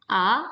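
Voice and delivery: A rising up; rising second tone. Shown as a demonstration.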